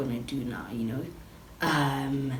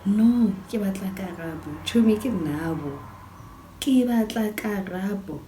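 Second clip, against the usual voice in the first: mock tearful insistence, raised and louder; imitated crying; 5 semitones higher, 5 dB louder than usual. Crying friend insists she wants Karabo, imitated tearful wail. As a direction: plead like a crying drunk friend, pitched up. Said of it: "Becky starts crying".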